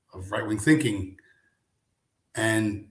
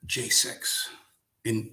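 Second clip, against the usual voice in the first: ordinary talk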